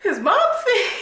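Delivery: high, loud words breaking into laughter; laughing. Real, not acted. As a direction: crack up while trying to say it. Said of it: "I can't even say without laughing".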